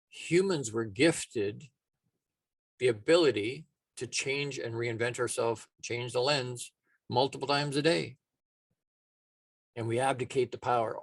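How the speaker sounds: usual speaking voice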